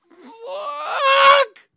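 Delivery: long, very high cry; despairing wail. Retold or imitated. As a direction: wail it out in anguish. Culprit despairs about the principal.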